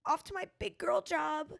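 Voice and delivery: high, singsong mock of a smug coworker; parroting people she finds annoying. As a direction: sneer it mockingly. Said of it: "when people are like".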